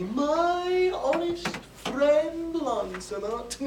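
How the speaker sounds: slow, drawn-out sage conscience; wise conscience. Acted. Conscience counselling its honest friend Lancelot, slow and wise. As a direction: stretch the words out with mock wisdom. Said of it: "very wisely to me".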